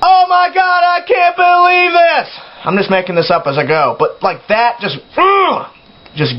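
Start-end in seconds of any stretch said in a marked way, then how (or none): 0.0-2.3 high, drawn-out monotone yell; mock metal yelling. Shown as a demonstration. Mocking bands yelling over technical riffs, high sustained yell. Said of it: "all you hear is". 5.2-5.7 high, exasperated "ugh"; frustrated groan. Acted. Vlogger lost for words at yelled vocals, frustrated groan.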